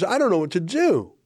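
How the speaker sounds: normal talking voice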